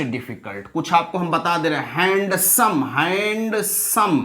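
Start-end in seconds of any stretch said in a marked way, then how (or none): 1.9-4.2 "हैंडसम" split slowly as हैंड-सम; broken into syllables. Shown as a demonstration. Teacher showing two syllables, "हैंडसम" pulled apart slowly. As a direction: say "हैंडसम" in two drawn-out beats. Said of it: "दो syllable हैं".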